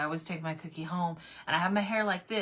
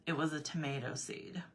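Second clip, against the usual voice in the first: softer unimpressed husband; retold deadpan reply; 2 semitones lower, 6 dB quieter than usual. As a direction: answer quietly, unbothered.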